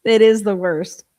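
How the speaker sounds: a loud, half-laughing cry about how bad it is; emphatic complaint. Real, not acted. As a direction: really lean on the worst.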